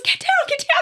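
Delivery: very high, loud retold panic; frantic shout. Friend urges her off the attic, acted frantic shout. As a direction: re-enact a frantic order to flee.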